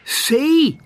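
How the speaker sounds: high excited call; shouted. A narrator's reading. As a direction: call it out proudly. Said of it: "he shouted".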